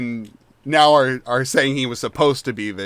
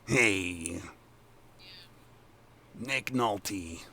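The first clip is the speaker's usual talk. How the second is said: lower, much softer raspy try; a quick lowered Nolte attempt; Nick Nolte; 5 semitones lower, 12 dB quieter, much slower than usual. GM tests a Nick Nolte voice for Chucklehead, low. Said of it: "like, a Nick Nolte before".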